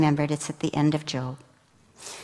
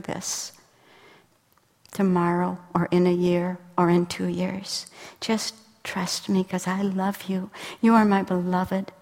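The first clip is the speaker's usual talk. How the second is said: tender reassurance; 4 dB quieter than usual. Retold or imitated. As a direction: reassure gently, let it sink to a whisper. God reassuring, fading to whisper.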